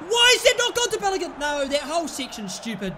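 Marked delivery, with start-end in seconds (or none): 0.1-1.3 much higher, louder and fast; angry rapid outburst. Real, not acted. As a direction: rant at the missed pass.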